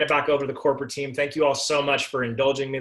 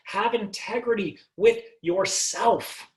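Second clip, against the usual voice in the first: ordinary talk, nothing marked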